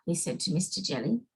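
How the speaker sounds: ordinary delivery, nothing marked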